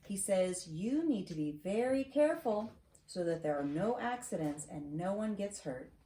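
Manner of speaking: ordinary delivery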